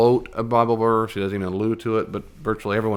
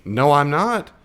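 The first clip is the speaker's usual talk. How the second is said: frustrated denial; 6 semitones higher, 6 dB louder, slower than usual. Retold or imitated. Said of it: "it should be".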